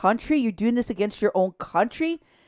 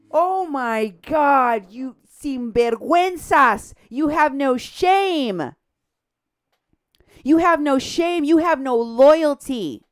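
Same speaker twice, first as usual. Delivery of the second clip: drawn-out, raised-pitch indignation; outraged scolding; 4 semitones higher, 4 dB louder, slower than usual. Real, not acted. Host shaming the activists for disloyalty, outraged scolding. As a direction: stretch every word in real outrage. Berating the people she exposes.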